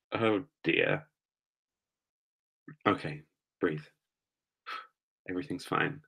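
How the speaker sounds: very soft, hushed aside to oneself; self-soothing. Real, not acted. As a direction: talk yourself down under your breath, with a sigh. Flustered streamer calming herself down.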